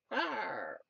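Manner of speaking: soft, falling little roar; half-hearted dinosaur noise; mock dinosaur roar. Speaker joking about dinosaur videos, soft mock roar. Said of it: "What's a dinosaur sound make?".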